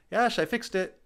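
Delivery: high, bright call of success; proud call. Acted. Steve calls to Ash, proudly announcing the fix. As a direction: announce the fix like a kid showing off.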